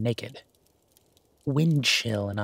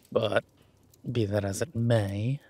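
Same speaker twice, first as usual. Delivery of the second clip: ordinary talk, nothing marked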